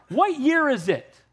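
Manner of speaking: slow, high, leaning on what; a teasing trap question, drawn out; what stretched high and slow; pointed question. Retold or imitated. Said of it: "you know what I said to her".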